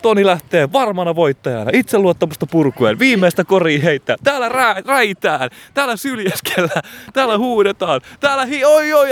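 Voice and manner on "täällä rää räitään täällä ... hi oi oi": rising excitement. Pretend. Rising crowd chaos commentary.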